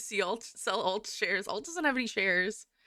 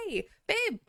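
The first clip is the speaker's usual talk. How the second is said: lightly voiced boyfriend, pitched up; imitated boyfriend; 10 semitones higher than usual. Streamer imitating boyfriend calling her aside, lightly voiced. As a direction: call to a partner in a high imitation.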